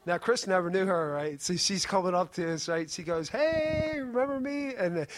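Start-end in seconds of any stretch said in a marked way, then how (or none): 3.3-4.7 high, drawn-out "hey" calling out; retold eager greeting. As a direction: imitate a friend's high-pitched hello. Retold greeting from Mother Goose.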